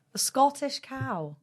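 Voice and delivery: slow, high put-on Scottish accent. Announcing the cow in a Scottish accent. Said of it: "what accent would you like".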